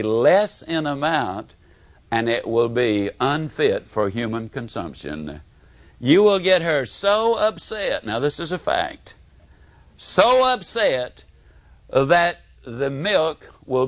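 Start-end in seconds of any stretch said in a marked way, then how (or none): none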